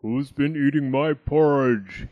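loud, growly big bear; growling Daddy Bear. A narrator's reading. Daddy Bear finds his porridge eaten, growling. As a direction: growl it out loudly. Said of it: "he growled".

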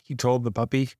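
usual speaking voice

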